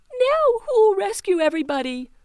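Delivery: high, loud anxious question; worried cry. Acted. Teeny left alone at the bucket, high and worried. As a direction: panic at being left alone.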